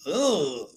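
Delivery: a hammed-up ugh of disgust, like recoiling from something; imitating a husband's grossed-out groan; mock disgusted groan. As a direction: play the husband's horrified grunt, half-joking. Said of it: "I gotta get into character".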